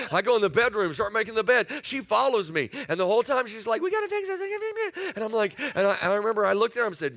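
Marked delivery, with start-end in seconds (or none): 3.8-5.1 much higher, squeaky nagging wife voice; high-pitched mock nag chasing him room to room; mock yappy wife. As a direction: squeak it like a pestering little dog. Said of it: "You remind me of a chihuahua".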